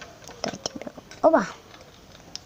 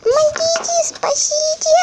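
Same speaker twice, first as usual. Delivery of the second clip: high squeaky toy crying for help; fluttering cries for help; 6 dB louder than usual. Pretend. (тоненькие крики о помощи)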